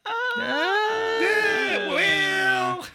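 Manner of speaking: squeaked hesitant well; high, drawn-out squeaky yeah. Pretend. Playful hesitant drawn-out reply.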